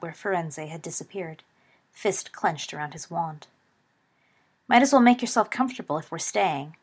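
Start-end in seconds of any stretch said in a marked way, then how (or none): 4.7-6.8 a light quip; quipping. A narrator's reading. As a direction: toss it off as a joke. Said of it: "quipped James".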